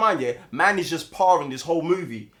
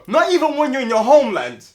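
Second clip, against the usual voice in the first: loud, raised-pitch disbelief; exasperated rant; 6 semitones higher, 9 dB louder than usual. Real, not acted. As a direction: belt it out, scandalised. Ranting about the actor's accent.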